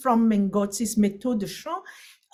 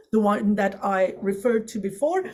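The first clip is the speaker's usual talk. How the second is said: normal talking voice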